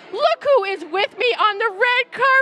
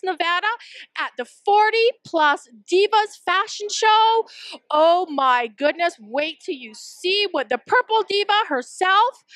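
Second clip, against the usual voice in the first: gushing red carpet chatter; 12 semitones higher than usual. Acted. Red carpet host segment.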